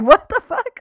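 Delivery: high, loud shocked outburst; shocked disbelief. Retold or imitated. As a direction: blurt it out in disbelief. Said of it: "he was like".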